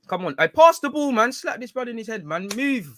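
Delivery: high-pitched exasperated urging; real frustration. Real, not acted. Fan urging players to pass the ball and move, frustrated. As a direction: burst out high, fed up with the players. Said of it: "This is frustrating me".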